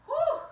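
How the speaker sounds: breathy high whew after the effort; exhaling whew. Real, not acted. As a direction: blow out a high relieved whew. After a hard work set.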